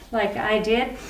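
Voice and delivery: usual speaking voice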